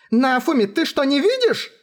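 high, astonished question; shrill amazement; incredulous. Acted. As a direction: ask in disbelief, pitched right up. Melty astonished he cannot see.

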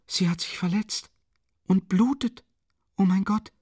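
high, frightened outcry; frightened young woman, pitched high; alarmed cry. A narrator's reading. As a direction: cry out over the fallen woman. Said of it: "rief Sonja aus".

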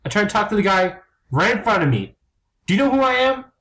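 high, heated tirade; angry actor shouting at the monster; furious rant. Acted. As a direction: yell him down, outraged.

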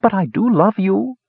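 put-on high, wounded old-woman voice; soft hurt protest. A narrator's reading. Old woman rebuffed by her captive, hurt protest. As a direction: protest as if your feelings are hurt. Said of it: "Zorna protested softly, her feelings hurt".

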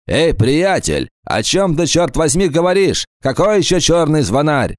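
a rattled gangster demanding answers; shocked thug voice. Acted.

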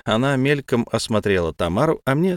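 ordinary delivery, nothing marked

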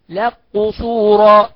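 "لك قصورا" as laq-qusura, slow and loud; kaf merged into qaf. Shown as a demonstration. Teacher demonstrating the merge, "لك قصورا" drawn out as laq-qusura. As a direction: turn the ك of "لك" into a doubled q. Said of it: "يبقى ندغم، نقول ايه؟".

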